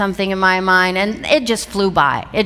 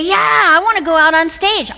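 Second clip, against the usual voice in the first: eager; 8 semitones higher, 4 dB louder than usual. Retold or imitated. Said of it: "some of you would say".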